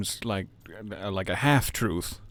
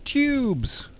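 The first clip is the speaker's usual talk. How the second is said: very high, falling holler; playful shout. Pretend. Streamer jumping down a tube in the game, high gleeful shout. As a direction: call it out high as if diving down a slide. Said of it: "I want to shout".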